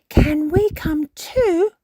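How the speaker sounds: slow, whispered eager question; whispered plea. A narrator's reading. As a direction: ask hopefully in a hush. Peppa asking eagerly.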